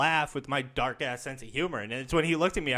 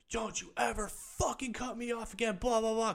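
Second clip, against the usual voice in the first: retold biker yell; 10 semitones higher, 5 dB quieter than usual. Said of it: "He started yelling".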